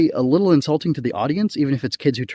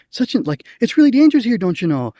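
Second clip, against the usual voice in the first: mimicking Midwest accent; 10 semitones higher, 4 dB louder than usual. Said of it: "she says something to her like".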